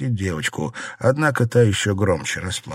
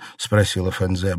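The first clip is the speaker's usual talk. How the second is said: plain reading voice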